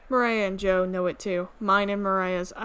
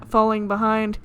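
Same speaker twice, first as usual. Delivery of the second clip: normal talking voice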